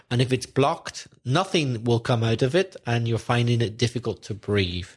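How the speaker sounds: usual speaking voice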